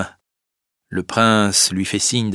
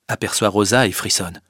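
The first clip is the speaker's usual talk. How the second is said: plain reading voice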